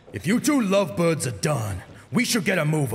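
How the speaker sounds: loud, teasing tough guy; gruff Barrett voice. Acted. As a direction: needle them like a big bruiser. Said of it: "Barrett teases".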